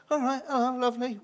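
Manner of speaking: high, cheery mock greeting; breezy hello to a staring pub; chirpy hello. Clown greets the staring locals with a cheery hello.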